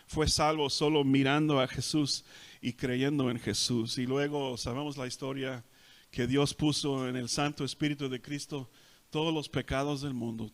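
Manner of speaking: normal talking voice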